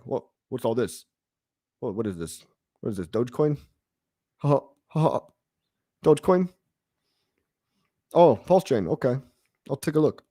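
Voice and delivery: a hint of Elon squinting at a card; bad Elon impression. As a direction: do a half-hearted Elon Musk reading the card. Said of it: "my shit Elon impression".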